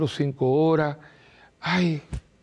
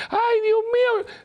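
much higher, drawn-out hammed-up wail; mock panicked wail; 3 dB louder, slower than usual. Mimicking someone shrieking in panic about the crisis, hammed-up high wail. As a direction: wail it like a frightened person losing control. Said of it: "con esta gritería".

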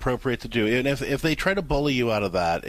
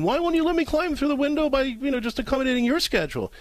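mock whiny mom; 12 semitones higher than usual. Mother whining at her daughter from the backyard, high mock wail. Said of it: "standing in the backyard screaming".

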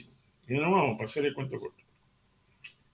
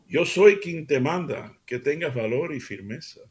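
slow, earnest Bible reading; scripture reading; 3 semitones higher, slower than usual. Acted. Host reads God's words to his radio family, earnest.

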